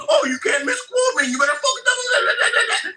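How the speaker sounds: high, drawn-out whiny tantrum; mock crybaby. Accuser mocks Steve's tantrum about being misquoted, whiny crybaby. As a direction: imitate a crybaby in a fit. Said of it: "fucking tantrum crybaby show".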